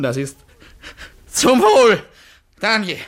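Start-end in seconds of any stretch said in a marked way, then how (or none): 1.3-3.0 put-on louder, higher drunken toast. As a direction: raise the glass and call out. (lauter Trinkspruch)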